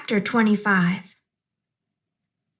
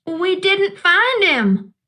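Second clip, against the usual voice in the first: shouted; 8 semitones higher, slower than usual. Acted. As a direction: yell it out, desperate the dog is lost. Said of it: "I shouted at them".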